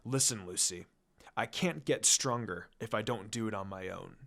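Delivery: a grave warning, kept quiet; serious tone. A narrator's reading. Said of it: "he says in a serious tone".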